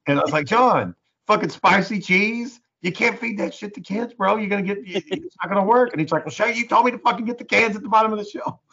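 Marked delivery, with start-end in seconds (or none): 0.5-5.9 high-pitched, exasperated retelling; incredulous mock telling-off, pitched up; exasperated scolding. Retold scolding of coworker. 6.2-8.6 high, whiny defensive comeback; mock-indignant protest in a raised voice. Coworker's retold defensive comeback.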